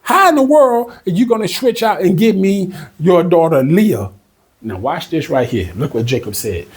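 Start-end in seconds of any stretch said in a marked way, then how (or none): 0.0-4.1 imitating Jacob's outrage, pitched higher; mock angry yell. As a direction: play the cheated nephew yelling at his uncle. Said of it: "probably yelling at, at his uncle Laban".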